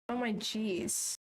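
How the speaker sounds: ordinary delivery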